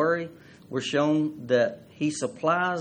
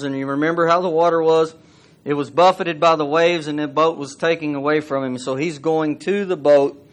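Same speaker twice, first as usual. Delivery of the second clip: loud, driving sermon voice; retelling the storm with rising force; fiery preaching; 2 semitones higher, 7 dB louder than usual. Acted. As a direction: preach the scene out to the congregation.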